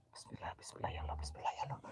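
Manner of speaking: fast, voiceless prayer; hurried frightened whisper. Real, not acted. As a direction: rush the whisper out in fear.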